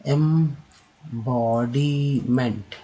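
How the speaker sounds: Embodiment drawn out slowly; slow syllable demo. Shown as a demonstration. Teacher slowly modelling embodiment. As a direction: spell embodiment out by sound. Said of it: "next है".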